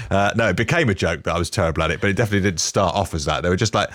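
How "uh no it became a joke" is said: ordinary talk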